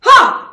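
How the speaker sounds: loud, high, explosive "ha"; forced "ha" exhale. Shown as a demonstration. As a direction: force all the breath out on one punched "ha". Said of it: "force all the breath out".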